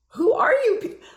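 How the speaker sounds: very high, loud mock squawk; retold incredulous outburst. Speaker replays reacting to the partying voice, squeaky incredulity. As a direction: shoot way up in comic disbelief.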